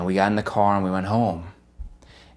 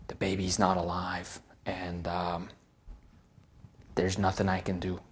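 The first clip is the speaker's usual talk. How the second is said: hushed, gentle bad news; quiet sorrowful news; 4 semitones lower, 12 dB quieter than usual. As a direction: deliver it softly, with regret. Midwife gives bad news softly.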